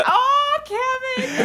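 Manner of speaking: high drawn-out worried plea; hammed-up anxious moan; mock dread. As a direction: cringe at husband's joke. Wife squeezing husband in dread.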